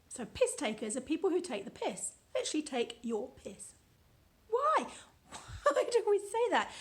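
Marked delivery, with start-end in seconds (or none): 4.5-6.7 much higher, laughing why; high amused squeal of disbelief. Real, not acted. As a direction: laugh at how absurd it sounds. Amused puzzlement at the phrase.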